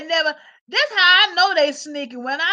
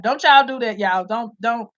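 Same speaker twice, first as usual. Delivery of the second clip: ordinary talk, nothing marked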